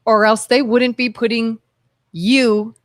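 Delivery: normal talking voice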